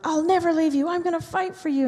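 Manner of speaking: earnest Peter. Retold or imitated. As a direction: pledge it eagerly. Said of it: "Peter stands up and goes".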